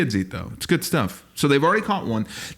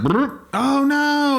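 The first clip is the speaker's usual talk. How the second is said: high, drawn-out comic dismay; mock dismay; 11 semitones higher, much slower than usual. Comic reacts to burglar's lost hat, mock dismay. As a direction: wail it high and slow in mock alarm.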